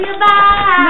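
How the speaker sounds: big shouted farewell; loud goodbye. Real, not acted. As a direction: call it out loud and high.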